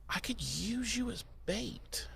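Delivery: slow, sly whisper; scheming whisper. Acted. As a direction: hush it like a sneaky plan. Buffy's sly realization.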